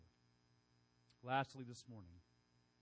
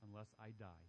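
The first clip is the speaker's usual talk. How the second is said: much lower, much softer solemn words; hushed, grave Jesus quote; 9 semitones lower, 16 dB quieter, faster than usual. Retold or imitated. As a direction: say it low and grave like a verdict.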